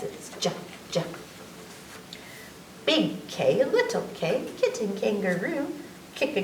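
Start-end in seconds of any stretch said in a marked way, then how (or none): 0.0-1.0 soft unvoiced j; J sound. Shown as a demonstration. Showing kids the J sound, breathy. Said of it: "the sound that J says".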